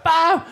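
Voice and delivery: high, loud bam; the wave hitting, voiced as a sound effect; mimicked crash. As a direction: punch out the impact. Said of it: "It's like".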